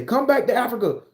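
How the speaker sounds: high, loud welcoming call; hammed-up come-home plea; mock inviting call. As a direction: lure them home with a sweet high call. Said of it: "they say".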